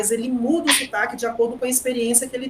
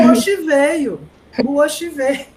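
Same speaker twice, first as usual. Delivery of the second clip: louder, higher dramatic announcement of O Oxi veio; dramatic exclamation; 5 semitones higher, 8 dB louder than usual. Retold or imitated. Teacher telling class the Oxi arrived, dramatic and loud. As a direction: proclaim it like a big reveal. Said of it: "retelling the Oxi moment".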